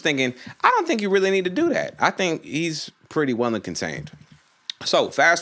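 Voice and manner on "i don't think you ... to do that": high, quick little inner voice; mock timid objection. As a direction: squeak out a rushed objection. Voices own thought at police overkill.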